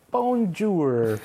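high French "Bonjour"; French greeting. Retold or imitated. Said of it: "I was like".

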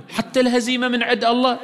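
high, disbelieving question; a questioner's high-pitched disbelief; incredulous question. Retold or imitated. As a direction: ask it as a shocked doubter. Voicing questioner's surprised objection.

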